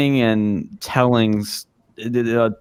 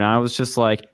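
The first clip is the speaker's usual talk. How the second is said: ordinary talk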